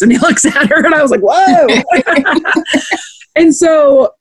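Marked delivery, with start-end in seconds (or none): 1.2-2.5 high whoa broken by laughter; laughing whoa. Retold or imitated. Viewer hearing Sam's cheeky joke, laughing whoa. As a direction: caught off guard and cracking up.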